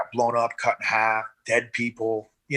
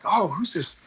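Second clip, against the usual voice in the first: mock admiring nurses; 5 semitones higher than usual.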